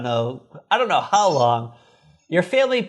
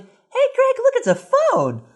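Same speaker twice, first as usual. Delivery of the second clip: put-on much higher, kid-brother voice; Bobby voiced. Podcaster voicing Bobby finding a phone, high and eager. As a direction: pipe up like an excited little boy. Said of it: "and Bobby's like".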